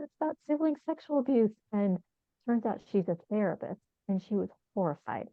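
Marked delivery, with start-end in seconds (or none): 0.0-1.5 much higher, eager retelling of her own proud answer; retold excitement. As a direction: replay how thrilled you were to say it. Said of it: "And I was all excited".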